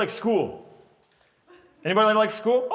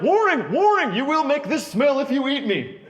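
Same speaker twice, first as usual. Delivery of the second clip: higher, louder robotic warning; broccoli alarm; 8 semitones higher, 4 dB louder than usual. Retold or imitated. Broccoli warning the eater, a mock robotic alarm. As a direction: sound the alarm like a siren voice. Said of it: "It's saying, like".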